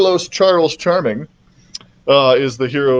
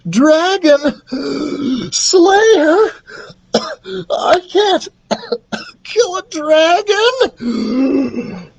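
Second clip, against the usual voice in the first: high, strained cries broken by gasps and coughs; gasping for air; much slower than usual. A narrator's reading. Carlos freed from mom's hug, gasping. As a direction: choke it out in a high panicky voice. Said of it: "Carlos gasped for air".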